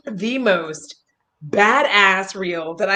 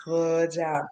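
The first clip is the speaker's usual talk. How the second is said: quiet self-talk; 6 semitones lower, 10 dB quieter than usual. Retold or imitated. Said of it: "I have literally tried to be like".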